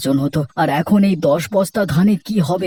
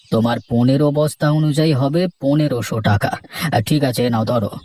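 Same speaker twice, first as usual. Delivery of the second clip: lower, measured headman's voice; village headman; 5 semitones lower than usual. Acted. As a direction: speak down to the farmers as the headman, lower. Headman pays farmer.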